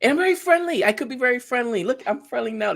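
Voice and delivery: high-pitched insistence on being friendly; supposedly friendly. Shown as a demonstration. Streamer demonstrating friendliness to co-host, high insistent friendly voice. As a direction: prove how friendly you are, pitch lifted. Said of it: "This is what I sound like when I'm friendly".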